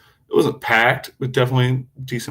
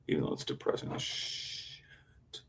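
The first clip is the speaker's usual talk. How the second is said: whispered aside; 6 semitones lower, 13 dB quieter, slower than usual. Real, not acted.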